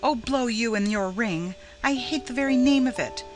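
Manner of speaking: high, exasperated outburst; maddened cry. A narrator's reading. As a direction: lash out at your friend, pitch climbing. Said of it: "cried Laura, maddened".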